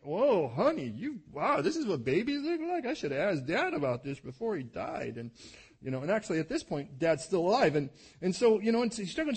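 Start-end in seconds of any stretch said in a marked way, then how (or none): 0.0-5.2 higher, louder, hammed-up stunned husband; husband gapes at his wife's huge pregnant belly, mock amazed. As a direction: play a clueless husband gawking at his wife. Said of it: "you could see, like".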